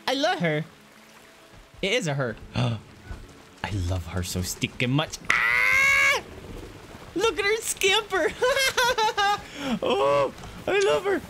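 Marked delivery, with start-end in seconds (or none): 5.3-11.2 very high squeals and a laugh; squealing delight. Real, not acted. Player watching the baby hippo scamper, squealing with delight.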